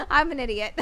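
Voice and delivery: high, loud burst of laughter around the words; rindo de si mesma. Real, not acted. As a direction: crack up loudly at your own blunder. Laughing at own mistake.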